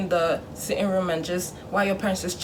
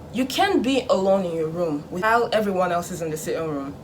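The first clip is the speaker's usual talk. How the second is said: normal talking voice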